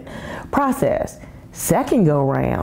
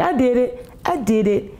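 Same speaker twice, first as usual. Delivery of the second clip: imagined triumph; 8 semitones higher than usual. Pretend. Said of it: "I'm gonna be like".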